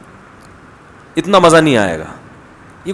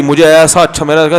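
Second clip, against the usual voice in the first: ordinary talk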